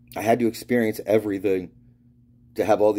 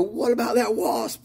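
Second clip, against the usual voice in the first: high, slow taunting holler; drawn-out high-pitched ribbing; mock teasing call; slower than usual. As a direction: needle a friend from afar. Acts out teasing friend about wasp.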